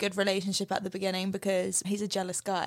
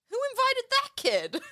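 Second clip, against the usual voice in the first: high, grumpy mock voice; Potato Head. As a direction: sneer like a sulky toy. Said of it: "Mister Potato Head's like".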